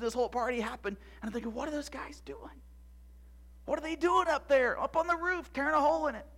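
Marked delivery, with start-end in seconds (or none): 1.5-6.3 high, puzzled questioning; wondering aloud, pitch lifted; bewildered. Acted. Boy watching men dig through the roof, bewildered. Acting out his bewildered thought.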